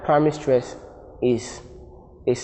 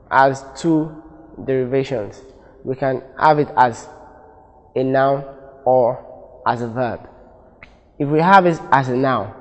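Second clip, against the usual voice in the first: ordinary delivery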